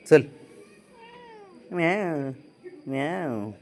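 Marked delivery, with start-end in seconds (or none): 1.7-3.5 imitated cat meow, soft; a playful meow back at the cat; mock meow. Owner meowing back at the cat, mock meow. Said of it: "imitating cat meow".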